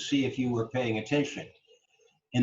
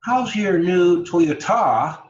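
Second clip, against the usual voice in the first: "Toyota" as toy-o-TA, whole question loud and high; slow, loud question landing hard on the TA of "Toyota"; wrong-syllable stress; 6 semitones higher, 9 dB louder, slower than usual. Shown as a demonstration. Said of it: "If we said".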